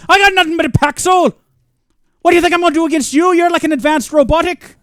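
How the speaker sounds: loud high bad Irish-Scottish brogue; bad Shrek Jacksepticeye voice. Gamer taunting the alien robot in a bad Irish brogue. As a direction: roar a hammed-up brogue challenge. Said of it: "bad Shrek slash Jacksepticeye impersonation".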